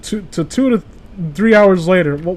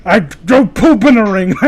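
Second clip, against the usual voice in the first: gimmick voice; 4 dB louder than usual. Pretend. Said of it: "That's my gimmick".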